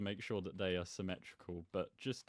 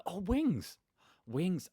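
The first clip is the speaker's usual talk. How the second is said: excited realization; 10 semitones higher, 7 dB louder, much slower than usual. Real, not acted. Suddenly remembers the fairy wings.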